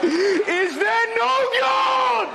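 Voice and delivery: very high, slow, drawn-out cry; mock anguished wail. Comic mock-despairing Englishman cries out, slow high wail. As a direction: wail it to the heavens. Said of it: "It is none of those things".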